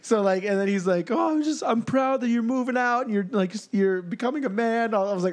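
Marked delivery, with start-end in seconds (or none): 1.1-4.9 high, mildly tearful dad imitation; mock crying. Dad proud of son moving out, mock crying. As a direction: imitate an overcome, weepy father. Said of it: "my dad calls me crying".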